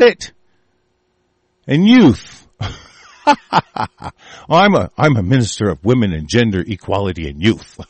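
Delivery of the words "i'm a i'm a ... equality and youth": mocking the minister. Mock imitation of the minister.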